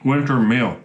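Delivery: talking with a mouth full of food; words pushed through a mouthful. Real, not acted. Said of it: "I shouldn't talk with my mouth full".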